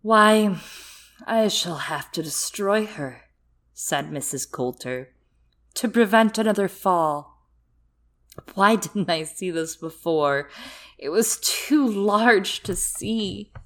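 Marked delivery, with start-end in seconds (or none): 0.0-3.2 cold villainess. Acted. Villain Coulter voiced in story. 5.8-13.5 cold villainess. Acted. Villain Coulter voiced in story.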